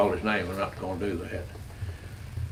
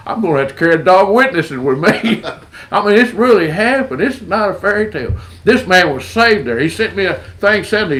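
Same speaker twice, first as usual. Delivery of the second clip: higher and louder, bubbling with joy; excited laughter; 7 semitones higher, 6 dB louder than usual. Real, not acted. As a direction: laugh through it with delight. Laughing joy after the dog hug.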